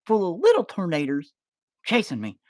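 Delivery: high put-on voice, slowed; high panicked voice. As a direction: sound urgent and goofy. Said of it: "I'm like".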